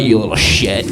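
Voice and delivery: normal talking voice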